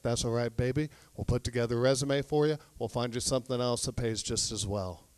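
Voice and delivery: husband consoling his miserable wife, hushed and tender; soothing husband. Acted. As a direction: comfort her quietly, like calming a child.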